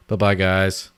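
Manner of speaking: normal talking voice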